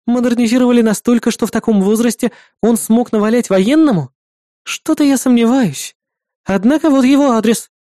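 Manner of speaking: dry, skeptical partner voice; skeptical young woman. Acted.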